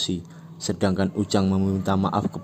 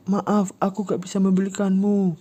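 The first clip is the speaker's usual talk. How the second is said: high, raised apologetic voice; high pleading; 12 semitones higher, 3 dB louder than usual. Acted. Ujang apologizing to Yura, high pleading. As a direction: say sorry pleading. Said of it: "Ujang berteriak".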